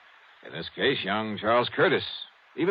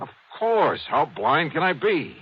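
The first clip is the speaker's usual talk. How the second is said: higher, louder, the penny drops; sudden realization; 7 semitones higher, 4 dB louder, slower than usual. Acted. Detective realizes the answer to Bancroft, exclaiming. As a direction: snap out the discovery, slow on the second line.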